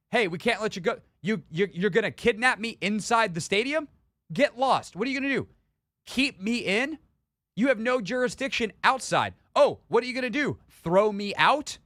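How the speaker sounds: pretending to be Chris sassing security guards, defiant; defiant retort. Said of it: "'Cause that's what I would have done".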